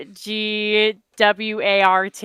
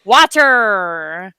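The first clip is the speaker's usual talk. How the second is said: drawn-out "water", louder, hard-hit first syllable; British accent; 3 semitones lower, 4 dB louder than usual. Retold or imitated. British teacher ordering a drink at the bar, imitated accent. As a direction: say "water" as a put-on, stretched accent word. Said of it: "and he went".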